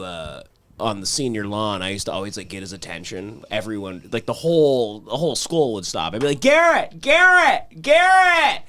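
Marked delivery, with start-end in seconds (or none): 6.4-8.6 high, loud calls of Garrett; yelling a name. Retold or imitated. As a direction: call out across the lawn to get his attention. Calling out across school lawn.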